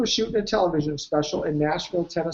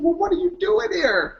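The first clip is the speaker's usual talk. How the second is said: much higher, teasing wonder; mock surprise; 12 semitones higher than usual. Teasing Heather for coming on TV, with high mock surprise. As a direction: act amazed she showed up.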